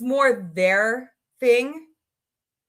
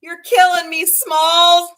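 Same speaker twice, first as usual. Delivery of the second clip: movie quote; 8 semitones higher, 8 dB louder, slower than usual. Retold or imitated. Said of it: "'Cause I like the Sandlot".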